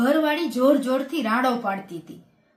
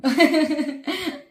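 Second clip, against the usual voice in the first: real laugh; 3 semitones higher than usual. Real, not acted. Laugh during punchline.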